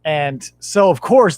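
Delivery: ordinary talk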